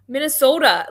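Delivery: "Minnesota" in a high, loud Minnesota accent; mock the Minnesotan long o in "Minnesota". Shown as a demonstration. Showing friends how they talk, exaggerated Minnesota accent. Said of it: "that's how you guys talk".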